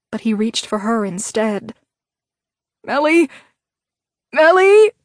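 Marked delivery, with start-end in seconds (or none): 2.8-4.9 high, loud, frantic calls; panicked crying out. A narrator's reading. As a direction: cry out her name in panic.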